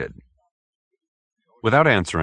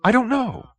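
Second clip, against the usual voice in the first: high, hurried, with a small gasp; quick nervous answer. Acted. Hoag answering the detective quickly, high and breathless. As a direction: blurt it out, catching breath. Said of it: "answered quickly, with a little intake of breath".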